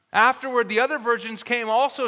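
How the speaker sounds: ordinary delivery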